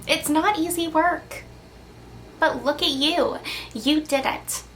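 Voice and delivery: ordinary talk, nothing marked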